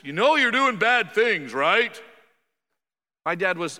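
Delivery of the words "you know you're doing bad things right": loud, high, drawn-out accusation; mock scolding parent. Voicing the scolding his dad never used, loud mock accusation. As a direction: scold slowly and loudly, pushing for agreement. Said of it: "my dad never really had this".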